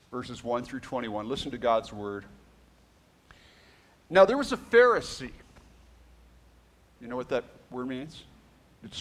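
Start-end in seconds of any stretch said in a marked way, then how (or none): 4.1-5.3 scripture reading. Acted. Reads scripture aloud.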